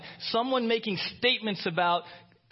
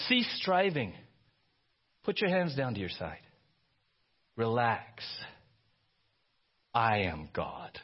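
slowing, softening, lower; calming God; 8 semitones lower than usual. Acted. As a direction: settle them down gently. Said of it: "he's like".

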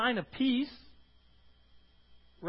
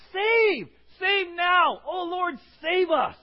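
loud, higher cry for help; desperate plea; 8 semitones higher, 7 dB louder, slower than usual. Retold or imitated. Preacher voicing people begging the Lord, loud pleading cry. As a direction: cry out to be saved. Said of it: "a plea, a cry for help".

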